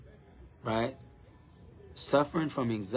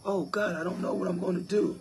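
higher, wailing Oh God; imitating a hopeless gospel lyric, pitched up; mock despairing singer; 6 semitones higher than usual. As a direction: parody a gospel-song lament. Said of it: "Y'all talking about".

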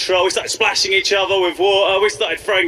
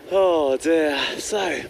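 usual speaking voice

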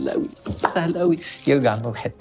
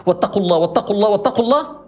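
each repeat pitched higher and louder; building repetition; 7 semitones higher, 3 dB louder, faster than usual. Real, not acted. Preacher drives the verse home, rising each time. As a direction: climb with every repeat.

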